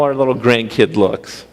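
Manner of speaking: usual speaking voice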